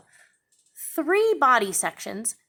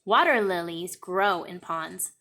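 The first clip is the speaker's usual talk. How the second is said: ordinary talk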